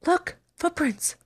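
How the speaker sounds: usual speaking voice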